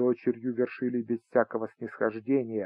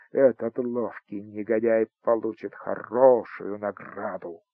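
ordinary delivery

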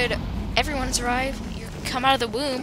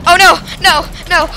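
louder, higher shouts of no; loud dismay; 6 semitones higher, 13 dB louder than usual. Acted. Gamer watching others die, loud dismay. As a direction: cry out in dismay.

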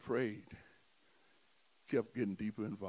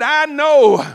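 loud insistence; 23 dB louder than usual. Shown as a demonstration. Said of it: "I said".

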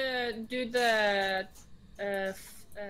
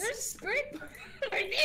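laughing; 11 semitones higher than usual. Real, not acted. Laughing at the spirit box.

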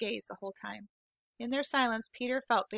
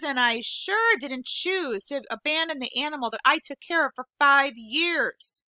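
tearful outburst; 5 semitones higher, 6 dB louder than usual. Acted. Boy near tears listing grievances.

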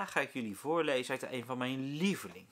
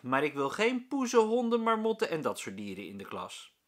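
higher, louder teacher laying down the rule; firm teacher; 5 semitones higher, 6 dB louder than usual. A narrator's reading. Meester Jaap refuses pets in class, firm and raised. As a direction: put your foot down cheerfully.

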